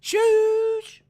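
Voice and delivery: long, loud, high shoosh; mimicked shoosh. Shooshing the crowd like the wrestler, loud drawn-out shoosh. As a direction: blast out a drawn-out shush.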